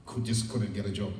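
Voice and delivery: ordinary delivery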